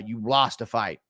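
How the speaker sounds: ordinary talk, nothing marked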